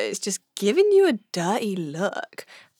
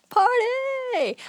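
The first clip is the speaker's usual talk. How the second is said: high, stretched-out mock cheer; playful high party cry; party whoop. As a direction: whoop it like a hen party setting off. Acting out hen party excitement.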